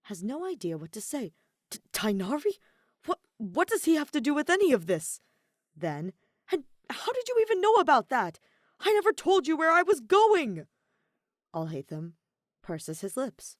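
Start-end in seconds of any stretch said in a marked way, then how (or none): 1.7-5.2 higher, louder flustered stammer; spluttering. A narrator's reading. Kaveh flustered at the Tainari mention, spluttering. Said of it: "Kaveh splutters". 6.5-10.7 much higher, much louder indignant yell; indignant shout. A narrator's reading. Kaveh yelling at Alhaitham about being tracked. Heated roommate argument.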